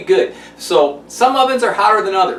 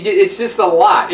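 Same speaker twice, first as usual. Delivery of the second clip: ordinary talk, nothing marked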